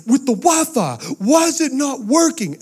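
put-on high, slow, whining child voice; whiny kid. Kids begging Daddy about the Wi-Fi, high whiny child voice. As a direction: plead like a panicked little kid. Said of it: "they're like".

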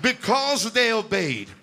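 low, hushed pastoral blessing over the couple. Real, not acted. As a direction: bless them gently, like a prayer. Pastor blesses couple, soft prayer.

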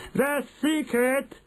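very high, slow build-up; triumphant announcement. A narrator's reading. Grand High Witch unveiling her secret, triumphant. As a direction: hold them in suspense, high and drawn out. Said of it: "announced the Grand High Witch triumphantly".

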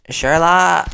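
loud call across the house. Acted. Partner calling through the flat, loud call. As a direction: call the name out to find him.

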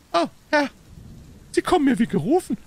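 hohe, laute Stimme voll Erleichterung; aufgeregtes Rufen. A narrator's reading. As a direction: dankbar und aufgeregt ausrufen. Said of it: "rief der kleine Bursche". (zittriges Männlein ruft erleichtert)

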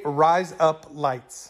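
very slow, each word drawn out; stretched-out demonstration of a phrase; slow modelling. Shown as a demonstration. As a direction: say it word by word for someone to copy.

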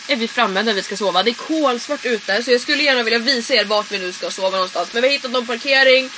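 ordinary delivery

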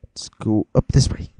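normal talking voice

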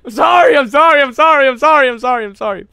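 loud, high, frantic yelling; hammed-up frantic apologizing; over-the-top apology. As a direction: shout apologies in a nonstop volley.

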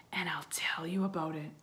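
soft spooky whisper. Acted. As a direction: draw them in with a whisper. Arthur begins spooky tale.

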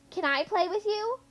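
high, hopeful question; asking brightly. A narrator's reading. Vowel letter asking to join the swings, hopeful. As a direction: ask sweetly to join in. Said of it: "the third letter asked".

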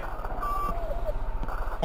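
very high, very slow drawn-out wail of fright; long terrified wail. Real, not acted. Player wails as the ghost stares at him, terrified. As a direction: stretch it into a horrified wail.

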